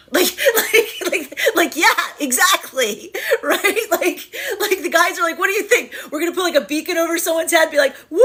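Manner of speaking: high, loud, cracking up mid-sentence; laughing too hard to talk evenly. Real, not acted. Cracking up while joking about beacon.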